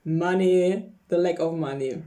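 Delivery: normal talking voice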